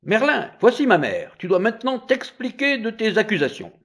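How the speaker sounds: high, impatient command; irritated judge. A narrator's reading. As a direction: demand an explanation testily. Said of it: "commençait à s'irriter".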